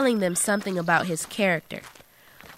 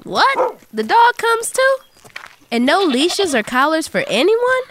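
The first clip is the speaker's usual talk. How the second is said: amazed; 7 semitones higher, 6 dB louder than usual. Real, not acted. Amazed at goats following.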